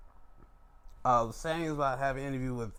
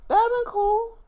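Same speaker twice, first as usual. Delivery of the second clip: excited; 10 dB louder than usual. Real, not acted. Excited wrestling fantasy booking.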